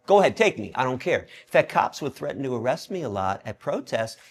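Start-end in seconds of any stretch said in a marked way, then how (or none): 0.1-1.2 defiant shout. Retold or imitated. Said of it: "I wa-, I".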